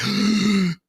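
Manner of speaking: high startled gasp; sharp gasp. Retold or imitated. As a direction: gasp as the monster appears. Monster rounds corner.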